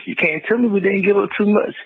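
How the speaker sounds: heated rant. Real, not acted. Caller ranting to the hosts about the trade, heated and high.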